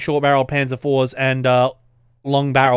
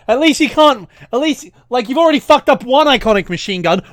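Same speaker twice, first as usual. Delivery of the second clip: sarcastic rant; 10 semitones higher, 6 dB louder than usual. Real, not acted.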